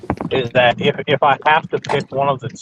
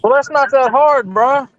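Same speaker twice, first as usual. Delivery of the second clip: higher-pitched Ricky voice, a bit louder; doing Ricky; 10 semitones higher, 4 dB louder than usual. Retold or imitated. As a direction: channel Ricky from one state over, pitched up. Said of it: "that sounds like Ricky".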